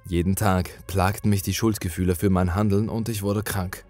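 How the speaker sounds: ordinary delivery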